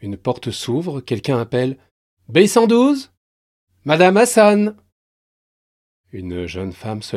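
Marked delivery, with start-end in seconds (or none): 2.3-4.8 appel aigu et fort dans la salle. Acted. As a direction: appeler le numéro à la cantonade. Quelqu'un appelle en salle d'attente. (appel d'accueil, plus haut et plus fort)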